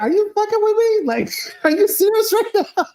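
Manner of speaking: very high, laughing incredulity; hammed-up high-pitched outrage; mock disbelief. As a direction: squeal it, cracking up at the memory. Retold disbelief at walking in.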